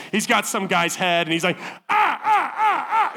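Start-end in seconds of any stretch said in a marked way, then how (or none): 1.9-3.1 high, strained mock-fighter ahs; high hammed-up grunts of a fighter. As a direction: ham up a grunting commando. Imagined Tommy as special forces.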